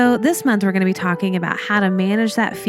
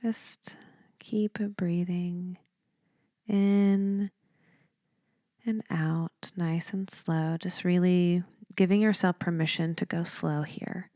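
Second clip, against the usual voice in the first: softer, unhurried meditation voice with long pauses; guided meditation; 7 dB quieter than usual. Real, not acted. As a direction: lead them gently through each breath, leaving space between phrases.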